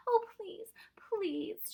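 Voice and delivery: put-on high, pleading little girl; begging. A narrator's reading. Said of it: "she begged".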